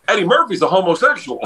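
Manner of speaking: imitating a driver blurting out gossip; blunt bus driver. Driver turns around to tell the comics, blurting. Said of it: "he goes".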